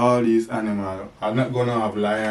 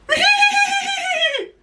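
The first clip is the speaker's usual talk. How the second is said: long high-pitched mock neigh; whinny loudly like a horse; 4 dB louder than usual. Shown as a demonstration. Dad teaches the horse sound to baby, high whinnying.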